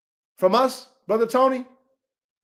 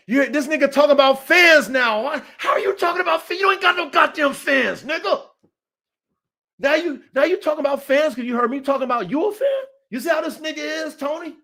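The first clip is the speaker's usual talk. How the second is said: higher, fast, heated yelling; angry shouting; 4 semitones higher than usual. Real, not acted. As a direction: blow up at the guy in chat. Angry rant at a chatter.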